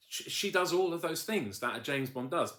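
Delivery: real excitement, voice climbing high; gushing excitement. Real, not acted. Reviewer gushing about a female James Bond, excited. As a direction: burst upward in delighted emphasis. Gushing praise for show's heroine.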